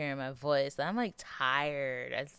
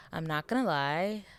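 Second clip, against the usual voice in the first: ordinary talk, nothing marked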